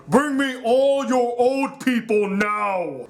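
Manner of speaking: drawn-out, slow imitation of a commander; hammed-up demand, stretching the words; mock villain order. Fire Nation arrives at the village, mock commanding.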